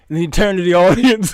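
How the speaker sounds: ordinary talk, nothing marked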